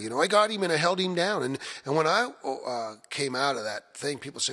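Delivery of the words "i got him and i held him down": higher, louder take on the American-sounding local accent; American small-town accent. Shown as a demonstration. Musician repeating how hometown locals talked, raised American-sounding accent. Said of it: "they would talk like that".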